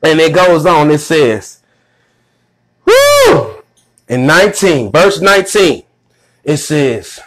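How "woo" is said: high, joyful whoop; excited whoop. Real, not acted. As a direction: let out a sudden high cry of joy. Preacher's exclamation between verses.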